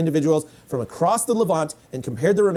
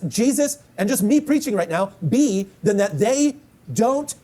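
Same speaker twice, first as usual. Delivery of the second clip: higher, slow and insistent; fiery preaching; 6 semitones higher, slower than usual. Acted. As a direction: preach it hard to the congregation. Preaching at full force.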